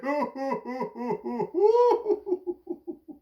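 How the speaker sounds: long run of high hoots; gleeful monkey laughter. A narrator's reading. Monkeys laughing down from the trees, gleeful hooting. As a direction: keep hooting with monkey glee.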